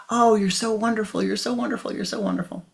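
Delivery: high, loud gushing that fades away; cobra's jealous parody of the farmer's cooing; mock gushing praise. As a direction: ham up the farmer's sweet talk to the cow. Cobra mimics farmer praising cow.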